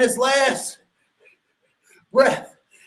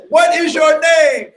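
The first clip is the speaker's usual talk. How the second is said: high, loud, slow demand; shouted demand; 6 semitones higher, 6 dB louder than usual. Retold or imitated. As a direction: bark the question at the boy. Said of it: "And he says".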